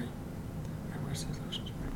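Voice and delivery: whispered aside. Real, not acted. Teasing a friend in a hushed whisper. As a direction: breathe the joke under your breath.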